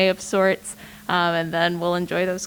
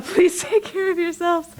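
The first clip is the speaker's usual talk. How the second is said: higher, laughing through the words; laughing plea; 10 semitones higher than usual. Real, not acted. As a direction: break into a laugh while urging care. Laughs while urging self-care.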